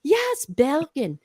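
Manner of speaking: slow happy Yes, Bergen, then a laughing high cheer; thrilled, ending in a laugh and a loud yay; delighted she got the right region, cheering; excited. Retold or imitated. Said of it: "And I'm going".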